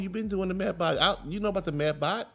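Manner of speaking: high, incredulous complaint to his wife; exasperated. Retold or imitated. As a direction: demand why she missed the notifications. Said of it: "I'm like".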